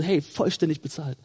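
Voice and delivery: soft, low and calm; ruhig gesagt. Acted. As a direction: say it quietly, as self-assurance. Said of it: "Mach das ruhig mal ruhig". (ruhig und leise)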